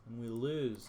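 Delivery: usual speaking voice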